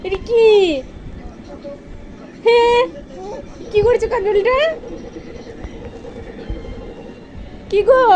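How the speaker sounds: pet talk. Acted. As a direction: coo at the pet like a little child. High voice to the pet.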